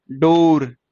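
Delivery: डोर with a long ओ; stretched vowel. Shown as a demonstration. Teacher showing ओ inside a word, stretched डोर. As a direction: hold the ओ before the final r. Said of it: "ओ की sound आ रही है ना डोर में".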